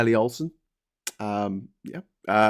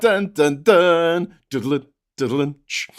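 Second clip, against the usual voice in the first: vocal "dun dun dun", raised then dropping; mock dramatic sting; slower than usual. Following terrifying tripod machines with a mock dramatic sting. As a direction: imitate a suspense sting with the mouth.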